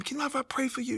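imitating her soft, high request; tender nurse. Nurse asks if she may pray for him, tender high voice. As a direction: pitch it up and ask very gently.

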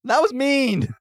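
high, loud scolding; indignant protest. Acted. Player scolding the golem for throwing her dragon, high loud protest. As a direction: cry out in indignation at a bully.

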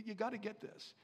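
normal talking voice

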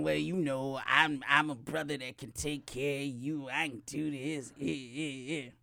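low, quiet smooth-talking brother; smooth guy voice. Retold or imitated. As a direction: drop deep and soft, selling himself. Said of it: "And he's saying".

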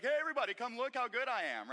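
mock-boastful emperor calling the crowd; preacher plays the vain emperor, bragging loudly. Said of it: "he'd be like".

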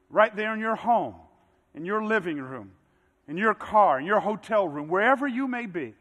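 usual speaking voice

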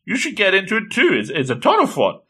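acted, upbeat pitch to join in; eager urging. Acting a girl urging him.

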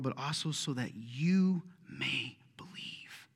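slow, hushed whisper. Real, not acted. Pastor telling the congregation they may believe, slow whisper. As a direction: lean in and breathe it to them.

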